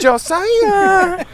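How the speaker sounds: high squeaky sing-song name call; funny high voice. Shown as a demonstration. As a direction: call the name in a silly falsetto. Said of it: "in a funny voice".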